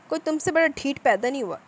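angry. Acted. Afan scolding Salar in anger. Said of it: "Affan ने गुस्से से कहा".

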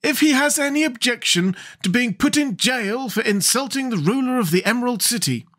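high-pitched, offended ruler; Scarecrow king. Acted. Scarecrow threatens jail for insult, high and regal.

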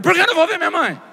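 high-pitched, exasperated protest; indignant outcry. Acted. Son arguing with hospital worker to see his mother, indignant outcry. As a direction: cry it out high, demanding an answer. Escalating argument with hospital woman.

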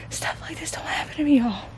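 awed whisper, breathless; giddy disbelief. Real, not acted. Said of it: "if I sound, like, giddy".

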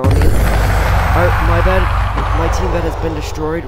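loud, high, alarmed; alarmed shout. Real, not acted. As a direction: panic as the bed falls. Alarm at bed destroyed.

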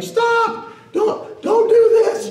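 high, loud desperate cry to stop; frantic shout. Acted. Young Washington yelling at his allies to stop, frantic high shout. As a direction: shout them down in a panic, voice cracking high.

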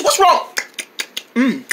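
ordinary delivery

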